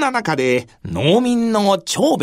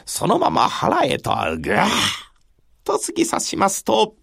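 plain reading voice

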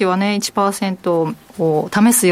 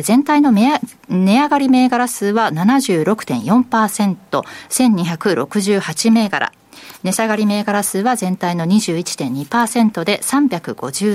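measured newsreader delivery, figures slowed; formal radio market round-up; market report read; 2 semitones higher, slower than usual. Real, not acted. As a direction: read the closing numbers clearly and evenly.

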